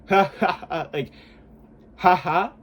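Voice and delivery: a plain, neutral demo laugh; just a neutral laugh, no push. Shown as a demonstration. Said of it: "That's, like, neutral".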